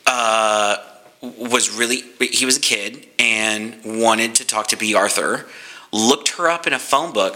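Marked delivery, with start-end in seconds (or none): none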